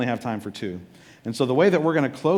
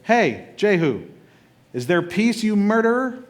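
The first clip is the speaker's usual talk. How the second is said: mocking queen; 7 semitones higher, slower than usual. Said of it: "and she goes".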